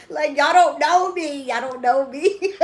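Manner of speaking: high, loud, drawn-out protest; laughing protest. Real, not acted. Telling viewers they don't know them, laughing protest. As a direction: call it out slowly, tipping into laughter.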